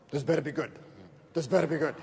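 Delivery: low, doubting friend grumbling at the door; suspicious friend. Retold or imitated. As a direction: play the wary friend daring you to impress him. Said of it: "this is how he walks in through the door".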